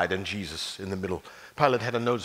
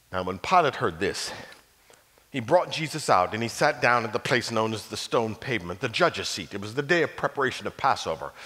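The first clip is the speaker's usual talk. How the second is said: ordinary delivery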